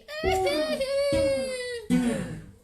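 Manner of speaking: high mock baby wail; imitated baby wail. Narrator imitates the crying baby, a high mock wail.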